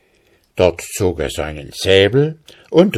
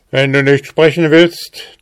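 loud, raised-pitch warning from an officer; threatening; 7 semitones higher, 6 dB louder than usual. A narrator's reading. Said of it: "drohte der Rittmeister". (drohend, laut und gehoben)

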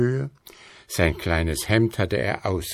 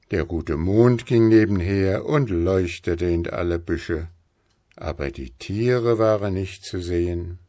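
plain reading voice

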